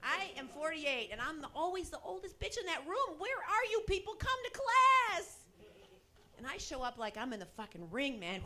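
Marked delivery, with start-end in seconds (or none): 3.2-5.4 higher, louder, stretched-out summons; a hint of a bossy coach; mock drill instructor. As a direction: call the stragglers in slowly. Bossing the yoga class.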